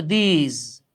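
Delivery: দিস drawn out as "theeez", long ee; pronunciation of these. Shown as a demonstration. Teacher modelling the word for students, slow pronunciation demo. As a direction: stretch দিস slowly, ending in a z like in জিম. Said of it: "এটা উচ্চারণ হচ্ছে".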